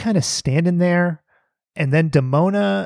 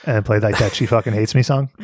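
usual speaking voice

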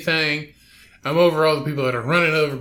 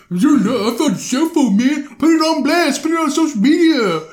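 mocking the protesters, much higher and louder; 11 semitones higher, 4 dB louder than usual. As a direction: ham up their phone announcement.

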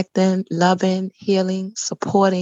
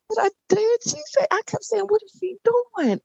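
high tearful retelling; 14 semitones higher than usual. Retold or imitated. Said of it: "I started crying".

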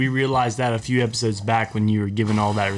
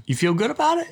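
incredulous; 11 semitones higher, faster than usual. Real, not acted. Skeptical echo question.

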